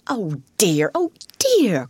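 exasperated lament climbing high; despairing. A narrator's reading. Rat despairing at mole's obtuseness, despairing. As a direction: groan in despair at his obtuseness. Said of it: "cried the rat in despair".

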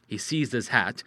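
ordinary delivery, nothing marked

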